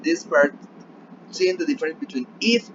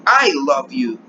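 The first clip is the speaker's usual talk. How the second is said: emphatic "I" leading the phrase; stress on I; 8 dB louder, slower than usual. Shown as a demonstration. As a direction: punch the word "I", let "love you" drop. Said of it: "it's different if I say".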